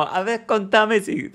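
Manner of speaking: high, slow, laughing passive-aggressive; sweet on top, sharp underneath; mock passive-aggressive message. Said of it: "toda pasiva-agresiva".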